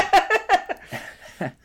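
helpless laugh at the joke; high burst of laughter. Real, not acted. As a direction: cracks up loudly.

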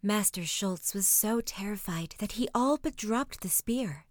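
usual speaking voice

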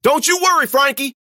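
very high, loud urgent cry; cried out. A narrator's reading. Narrator shouting up to hanging Frankie, high and loud. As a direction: shout reassurance up to her. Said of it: "I cried".

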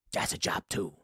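soft whispered throwaway; whispered aside. Real, not acted. Host to viewer, a whispered aside. Aside while listing jobs.

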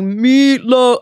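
weird put-on voice, badly faked; oddly off, hammed-up impersonation; mock botched man voice. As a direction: imitate a friend badly pretending to be a man. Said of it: "she sounded like something was fucking wrong".

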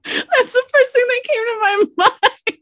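high, loud through laughter; laughing. Real, not acted. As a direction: talk while cracking up. Laughing hard.

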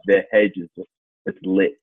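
usual speaking voice

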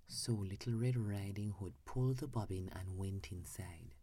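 plain reading voice

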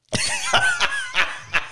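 sudden high squealing laugh; laughing at his own pick, high and squeaky; high burst of laughter. Real, not acted. Laughs at own Gary Busey pick.

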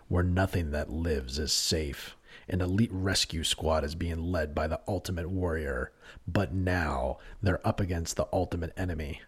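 low, soft movie trailer voice; deep dramatic trailer narration; trailer voiceover guy. Retold or imitated. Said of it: "I'm gonna go to his voice".

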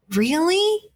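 high rising disbelief; incredulous. Retold or imitated. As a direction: question it as if you cannot believe your ears. Reacts to his outrageous text.

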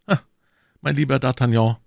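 a light touch of bitterness; understated bitter edge; slightly bitter. A narrator's reading. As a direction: address a friend with faint bitterness. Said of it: "mit einem leichten Anflug von Bitterkeit".

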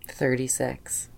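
whispering. Real, not acted. Hungover whispered answer.

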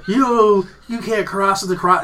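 high, loud mock scolding; imitating angry pedestrian. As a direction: act out an angry man telling off a driver. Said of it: "he was all pissed off".

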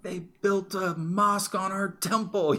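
squeaky put-on complaint from the guide; hammed-up quote of a bickering guide; imitating tour guide. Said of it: "It'd be like".

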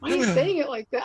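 ordinary delivery

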